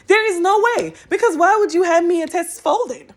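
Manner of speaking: high, loud half-laughing disbelief; high laughing outrage at being handed a folded paper; incredulous outburst. Real, not acted. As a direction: protest it as if it can't be true.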